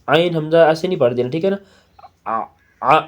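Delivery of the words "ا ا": ا ا said in the wrong order, shown as a mistake; ا ا read the wrong way for contrast; ا ا, the wrong way round; wrong pronunciation. Shown as a demonstration. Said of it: "ایسے نہیں پڑھنا".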